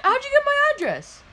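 very high, strained outburst; alarmed demand. Acted. Boy demanding how dad found his address, alarmed shouting. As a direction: shriek the question in disbelief.

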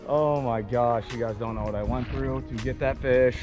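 normal talking voice

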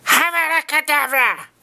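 high, slow theatrical magician. Pretend. Vlogger casts a mock spell, high and slow. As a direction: intone it like a stage wizard.

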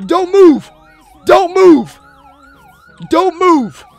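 high, loud police shout; yelling at suspect. Acted. Officer shouting at a suspect, full police yell.

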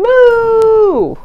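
high, loud moo; loud cow moo. Acted. Reading aloud, voicing a cow's sudden loud moo.

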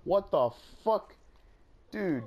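loud, high, outraged yell; angry yell. Real, not acted. Gamer shouting at a sudden kill, furious yell. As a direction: shout in real disbelief and anger.

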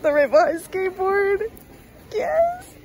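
high, loud, drawn-out excitement; elated, soaring pitch; thrilled squeal. Real, not acted. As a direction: squeal with delight over the find. Thrilled at finding skateboard.

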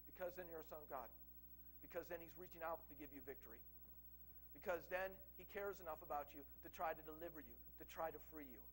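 a quiet preacher comforting sinners who feel condemned; soft reassurance. Acted. Tender reassurance after the rebuke.

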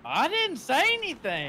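high, loud denial; playful arguing shout; indignant protest. Real, not acted. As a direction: protest innocence at the top of your voice. Mock quarrel over being drunk.

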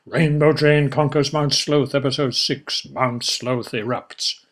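plain reading voice

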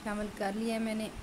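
ordinary talk, nothing marked

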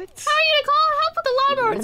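can't believe the idea; incredulous. Acted. A student mocking the lawnmower plan, high incredulous voice.

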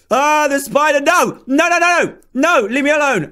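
high-pitched, alarmed protest; panicked cries. Real, not acted. Gamer begging a spider to leave him alone, panicked. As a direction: cry out to fend off an attacker.

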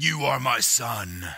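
slow, drawn-out divine pronouncement; solemn movie-style God voice. Acted. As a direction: stretch each word like God speaking from heaven. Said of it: "it just sounds like God".

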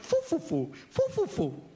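high puffing фу-фу-фу; blowing. Retold or imitated. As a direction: puff hard like blowing a dandelion. Grandpa blowing dandelion. (дед дует на одуванчик, высоко и с силой)

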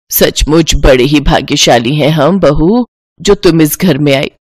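put-on an old in-law blessing the daughter-in-law; proud elder. Elder in-law praising bahu.

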